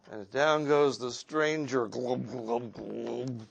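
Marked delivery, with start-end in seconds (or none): 1.9-3.5 glub sounds fading away; sinking glubs. A narrator's reading. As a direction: let the glubs sink and fade. Stranger sinking in water.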